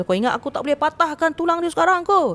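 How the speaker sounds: a hint of the spirit's menacing threat; angry threat. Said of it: "Dia marah". (ancaman marah makhluk, suara lebih tinggi sedikit)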